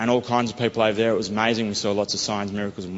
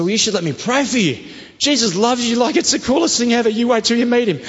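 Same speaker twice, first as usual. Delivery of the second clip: high, bright and louder, bursting with excitement; eager enthusiasm; 12 semitones higher, 5 dB louder than usual. Retold or imitated. Offering to pray for a girl, high and gushing. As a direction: sell it like the coolest thing ever.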